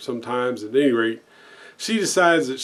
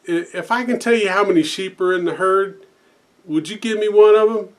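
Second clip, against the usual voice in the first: higher, louder, eager bargaining voice; eager blonde tourist; 6 semitones higher, 5 dB louder than usual. Retold or imitated. Blonde lady to the cowboy, eager and higher. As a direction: pitch the bet like an excited tourist. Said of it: "And, uh, she goes".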